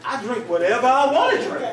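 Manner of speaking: loud, laughing, high sassy grandma; sassy grandma quote. Retold or imitated. Grandma telling grandson her secret, sassy. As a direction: toss it off like it's obvious. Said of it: "She was like".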